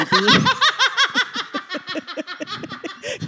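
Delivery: high, open burst of laughter; cracking up at her own castration mix-up; big laugh. Real, not acted. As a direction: let the laugh ring out.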